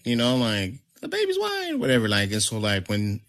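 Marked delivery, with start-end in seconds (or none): 1.0-1.8 high, hammed-up astonishment; mock shock. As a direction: imitate shocked relatives in a high pitch. Retold shocked reaction to baby.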